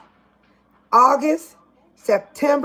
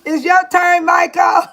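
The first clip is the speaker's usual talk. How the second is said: calling out; 6 semitones higher, slower than usual. Real, not acted. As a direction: shout it across to him. Calling out to Michael.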